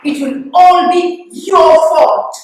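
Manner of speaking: loud, slow accusation; furious blame. Acted. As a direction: hammer out the blame word by word, slowly and loudly. Blaming the mother, tirade's climax.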